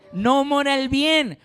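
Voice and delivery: agudo, fuerte y lento, leyendo en voz alta; lectura enfática alta. Shown as a demonstration. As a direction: proclama el versículo con fuerza. Lectura enfática del versículo. (proclamación del versículo, aguda y lenta)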